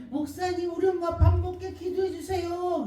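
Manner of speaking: high, loud begging voice; pleading daughter. Retold or imitated. Daughter begging the pastor to pray, high and loud.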